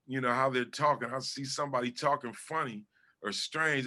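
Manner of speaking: usual speaking voice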